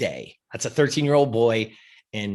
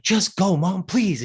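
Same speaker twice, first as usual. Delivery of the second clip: higher pleading; 7 semitones higher, slower than usual. Retold or imitated. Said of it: "I'm like".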